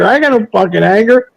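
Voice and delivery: ordinary delivery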